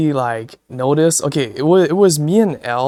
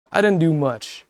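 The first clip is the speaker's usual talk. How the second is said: usual speaking voice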